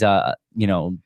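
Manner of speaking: ordinary talk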